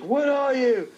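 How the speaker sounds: chef demanding impression. Retelling the show to friends, mock chef demand. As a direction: snap the question at her like a TV chef. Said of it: "he's like".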